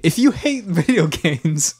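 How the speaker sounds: high, drawn-out spoof disclaimer; tongue-in-cheek public notice; mock announcement. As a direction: announce it slowly, as a joke warning. Mock disclaimer for game-talk skippers.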